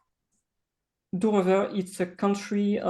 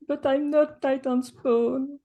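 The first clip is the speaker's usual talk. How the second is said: put-on a higher child's voice, protesting; scared child; 8 semitones higher than usual. Child protesting to the adventurer who holds her, in a higher voice. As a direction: squeak it out like a frightened little girl. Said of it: "and she goes".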